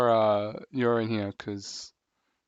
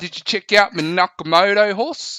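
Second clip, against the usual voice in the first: loud, higher Bogan Australian bloke; Bogan Australian accent; 6 semitones higher, 5 dB louder than usual. Pretend. Bogan bloke bragging to a mate about his horse, in a loud Bogan Australian accent. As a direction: play a rough Aussie Bogan bragging. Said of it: "the Bogan one".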